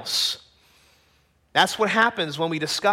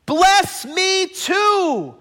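high, slow, drawn-out begging wail; anguished loud plea, full-voiced and high; loud bitter cry; 10 semitones higher, 5 dB louder, much slower than usual. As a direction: cry out to a father, stretching each word. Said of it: "he let out a loud and bitter cry".

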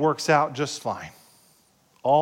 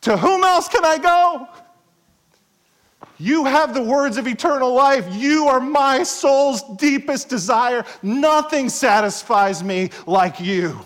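much higher and louder, slowing into longing; Peter's fervent cry; 8 semitones higher, 6 dB louder than usual. Retold or imitated. Peter tells Jesus he has nowhere else, fervently. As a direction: cry it out with your whole heart. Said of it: "You better say like Peter".

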